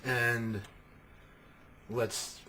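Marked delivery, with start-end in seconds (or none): none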